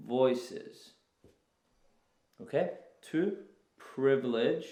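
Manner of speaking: each key phrase "Eliot's poetry", "voices", "wider" set apart slowly; slow, stressing key words. Shown as a demonstration. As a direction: read "So Eliot's poetry employs unique voices" with long pauses between phrases. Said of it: "you underline all the important concepts".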